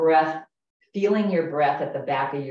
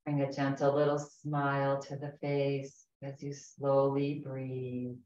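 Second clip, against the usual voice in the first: guided meditation. Acted. Leads a guided breathing meditation.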